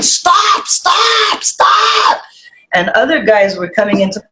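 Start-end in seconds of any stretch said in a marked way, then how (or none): 0.0-2.2 high crying shout of stop; retold panic, crying out stop at full pitch. Streamer retelling the cam show, shouting stop while crying. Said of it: "I was crying".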